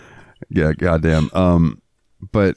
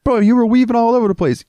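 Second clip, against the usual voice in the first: much higher, louder scolding shout; mock yell; 3 dB louder than usual. [name] scolding the drunk driver, high mock shout. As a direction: shout him down. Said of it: "[name] yelling at driver".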